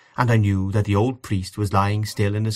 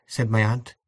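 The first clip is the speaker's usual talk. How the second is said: ordinary delivery, nothing marked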